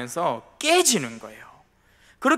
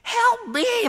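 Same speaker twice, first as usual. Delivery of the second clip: high shrill-pitched cry for help; mock yell for help. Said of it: "소리를 꽥꽥 질렀어요". (높은 소리로 꽥꽥 외치듯)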